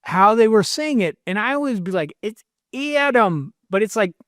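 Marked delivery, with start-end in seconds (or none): 2.2-3.5 slow, higher Great Lakes ADAM through the nose; nasal Lake Erie accent. Retold or imitated. Imitating Cleveland and Michigan people, nasal Lake Erie accent. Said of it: "goes right out through your nose".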